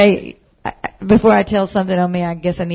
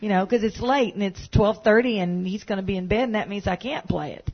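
ordinary talk, nothing marked

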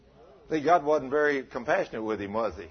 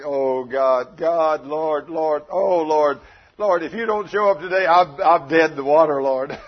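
louder, pleading cries of "Lord, Lord"; frantic pastor praying on the drive down; desperate begging prayer; 4 semitones higher, 9 dB louder, slower than usual. Acted. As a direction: beg God like your life depends on it. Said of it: "Oh, he's begging, pleading".

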